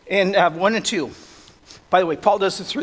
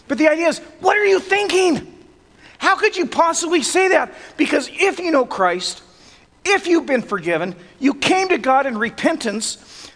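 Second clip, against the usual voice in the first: higher and louder, pressing each line home; preaching at full force; 5 semitones higher, 4 dB louder than usual. Acted. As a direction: preach it hard to the congregation.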